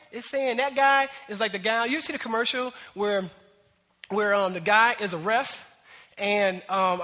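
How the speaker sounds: ordinary talk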